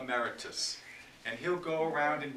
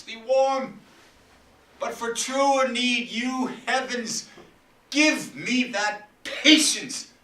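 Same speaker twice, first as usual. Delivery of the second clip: loud, higher, full stage declamation; impassioned Shakespeare; 5 semitones higher, 9 dB louder than usual. Acted. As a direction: rage at the daughters from the stage. Performs Lear's speech.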